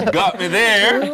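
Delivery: laughing out the words. Real, not acted. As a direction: laugh while admitting you were fooled. Host fooled by ackee dip.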